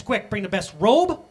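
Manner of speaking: the father hurries his servants to bring robe, ring and sandals; urgent father. Acted. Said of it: "says to his servants".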